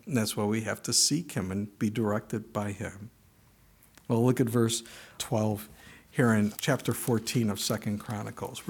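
usual speaking voice

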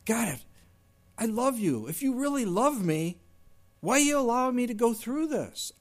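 a hint of a self-pitying prayer; complaining prayer. Preacher imitating a believer questioning God, lightly whiny.

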